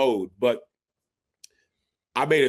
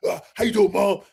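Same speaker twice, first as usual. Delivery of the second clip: higher-pitched mock macho greeting; put-on player persona; 7 semitones higher, 3 dB louder than usual. Son still in combine mode phoning mom, put-on bravado. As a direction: stay stuck in the tough role. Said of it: "Why are you talking like that?".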